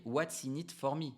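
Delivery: English with a real French accent, drawn out slowly. Real, not acted. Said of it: "mon super accent anglais".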